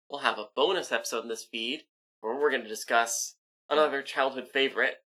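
ordinary talk

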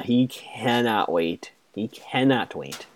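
slow, drawn-out teasing emphasis; gleeful slow repetition; slow relish. Real, not acted. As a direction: savour every word slowly. Relishes Caleb's coming confrontation.

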